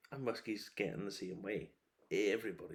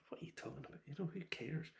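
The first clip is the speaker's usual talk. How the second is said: whispered aside; 6 semitones higher, 8 dB quieter, faster than usual. Real, not acted. Dismissive aside.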